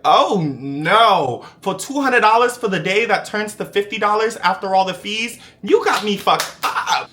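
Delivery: high, loud, sassy put-on voice; imitating himself telling them off; sassy refusal. To the agent about the pay, sassy and loud. Mocking his own sassy reply.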